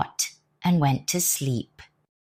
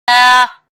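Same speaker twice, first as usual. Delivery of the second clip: much higher, louder scream; 7 semitones higher, 13 dB louder than usual. Acted. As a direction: shriek as the cat attacks. Cat leaps at robber.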